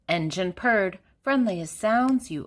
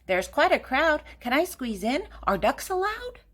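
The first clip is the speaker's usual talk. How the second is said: higher, squeaky duck asking; rising duck voice pleading to squeeze in; duck's voice; 7 semitones higher than usual. A narrator's reading. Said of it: "said Duck".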